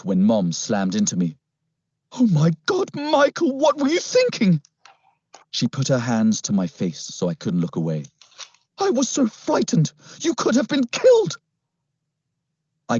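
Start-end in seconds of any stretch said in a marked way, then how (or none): 2.1-4.6 very high-pitched, frantic mother; frightened scolding. Acted. Mom slams into her son after his rescue, frightened scolding. 8.8-11.4 very high-pitched, frantic mother; frightened scolding. Acted. Mom slams into her son after his rescue, frightened scolding.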